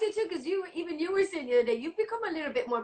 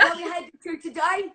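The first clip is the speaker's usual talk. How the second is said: higher, louder London cabbie; English cabbie accent; 5 semitones higher, 6 dB louder than usual. Acted. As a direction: put on an English cab driver's accent. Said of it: "I'll give you my best, uh, English".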